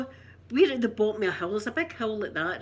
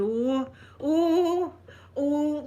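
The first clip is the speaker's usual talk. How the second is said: higher, louder mock cries of alarm; imitated alarmed oh; 5 semitones higher, 4 dB louder, much slower than usual. Old man in runaway wheelchair, mock alarmed cries. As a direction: play the old man rolling away, lightly. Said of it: "like that".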